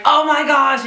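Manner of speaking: high-pitched excited gasp of news. Retold or imitated. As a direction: burst out with amazed joy. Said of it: "I'm so excited".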